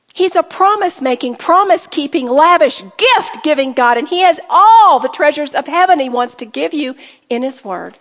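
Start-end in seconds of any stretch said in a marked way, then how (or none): none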